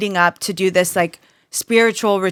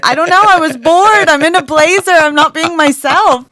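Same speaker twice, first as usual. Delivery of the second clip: much higher, louder whiny excuse; 8 semitones higher, 6 dB louder than usual. Retold or imitated. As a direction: plead like a sulky kid. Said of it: "I was like".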